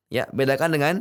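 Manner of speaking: ordinary talk, nothing marked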